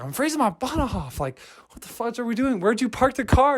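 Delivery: high-pitched acted exasperation; frustrated. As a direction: imitate a cold, fed-up bickering companion. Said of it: "just that frustration of being together".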